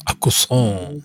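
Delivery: Aucassin said as "Ah-kuh-so" in a mock French accent, vowels rounded; stupid French accent. Shown as a demonstration. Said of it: "I like to do the stupid accent".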